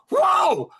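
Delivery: high-pitched burst of wonder; excited amazement. Retold or imitated. Husband retelling his reaction to her realization, excited. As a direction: let out a thrilled, high exclamation. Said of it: "I get excited".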